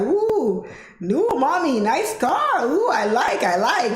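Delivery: high, swooping coos of approval; playful, sing-song impression of an impressed valet; admiring valet lady. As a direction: imitate a sweet lady gushing over a car.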